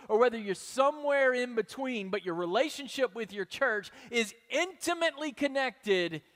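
preaching. Acted. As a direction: press it home to the congregation. Preaching at full force.

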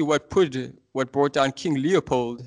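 usual speaking voice

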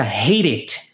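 "hate it" run together, the T tapped into "it" like "heirit"; linked hate it. Shown as a demonstration. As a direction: link "hate" straight into "it" with a tapped T. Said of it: "ele emenda com o it". ("hate it" emendado, o T soando como R)

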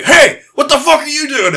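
high, loud challenge; mock aggressive shout. Neighbour dog challenging over the fence, mock aggressive shout.

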